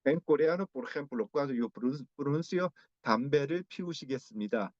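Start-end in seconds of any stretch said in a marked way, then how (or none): 3.0-4.7 fast Korean, each syllable crisp and clear; 빠르고 또렷한 한국어 발음; Korean demo. Shown as a demonstration. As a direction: rattle off the Korean sentence quickly. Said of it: "Así yo pronuncio cada sílaba muy claramente".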